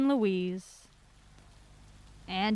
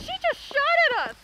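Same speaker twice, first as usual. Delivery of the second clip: shocked cry. Acted. Bullet fired into the trunk.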